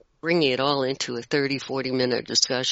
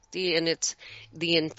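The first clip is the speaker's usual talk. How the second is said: ordinary talk, nothing marked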